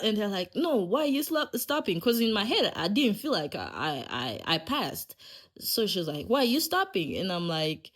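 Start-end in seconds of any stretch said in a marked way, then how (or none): 0.5-2.0 high-pitched mock protest; incredulous questioning. Examiners asking the learner why she stops, incredulous. 6.3-7.2 high retold question; incredulous questioning. Examiner asking the learner why she stops, incredulous.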